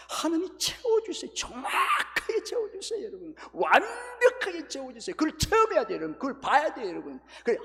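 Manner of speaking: high, loud, tearful cry of praise; fervent climax. Real, not acted. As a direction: cry it out with overflowing joy. Preacher climaxing emotionally.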